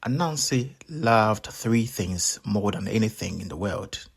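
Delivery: plain reading voice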